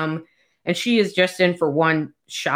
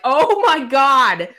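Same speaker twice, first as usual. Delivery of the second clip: mock shock; 8 semitones higher, 5 dB louder, slower than usual. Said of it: "And I was like".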